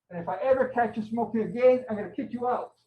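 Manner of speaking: stern father. Retold or imitated. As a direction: scold like an angry dad. Father scolding brother, prop cigarette.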